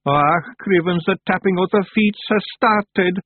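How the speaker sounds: high moan of dread. A narrator's reading. Daft Woolly dreads Tiffany's tapping foot, moaning. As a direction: moan like a doomed wee creature. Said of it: "moaned Daft Woolly".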